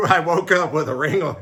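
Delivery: loud, high mock of her words; mocking quote. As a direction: parrot her line back, loud and teasing. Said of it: "You can't just say".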